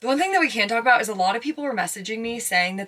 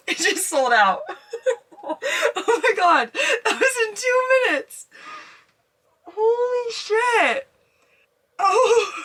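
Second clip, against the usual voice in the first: high, slow, tearful laughter; overwhelmed joy as the trip sells out; laughing crying; 12 semitones higher, 4 dB louder, much slower than usual. Real, not acted. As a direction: laugh and half-sob in disbelief. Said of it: "I'm literally gonna cry".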